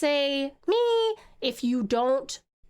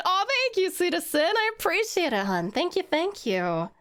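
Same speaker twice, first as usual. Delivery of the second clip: character voice; 7 semitones higher than usual. Acted. Character thanking chat.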